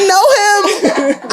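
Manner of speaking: high, drawn-out comic outburst; slow, high-pitched playful wail; mock recognition. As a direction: cry out in mock dismay, half laughing. Comic retold reaction to hearing Gemini.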